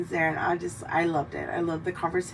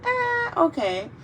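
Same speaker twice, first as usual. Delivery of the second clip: high, slow, unimpressed "eh"; unimpressed shrug; 12 semitones higher, slower than usual. Retold or imitated. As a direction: shrug it off half-interested. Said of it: "I'm just like".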